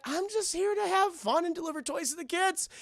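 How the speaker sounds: high, earnest mock-innocent voice; innocent Kris Kringle. Kringle answering the hostile town, high innocent voice.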